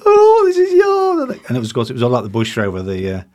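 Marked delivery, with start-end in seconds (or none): 0.1-1.4 mock squeal, very high and loud; imitated delighted exclamation. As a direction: draw it out, shrieking like a thrilled guest. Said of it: "she went".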